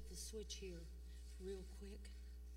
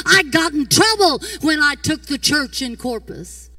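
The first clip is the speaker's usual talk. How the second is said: shouted, rising preacher's cry, higher and louder; preaching at full force; 4 semitones higher, 15 dB louder than usual. Acted. Preacher challenging the congregation, shouting. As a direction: build the sermon to a roaring shout.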